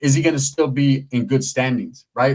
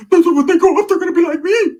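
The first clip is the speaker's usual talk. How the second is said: high, loud, cocky cousin voice; cousin's proud reply; 6 dB louder than usual. Retold or imitated. Cousin defending his kids' future, cocky and loud. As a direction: boast it like he's proud of it.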